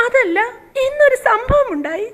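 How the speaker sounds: usual speaking voice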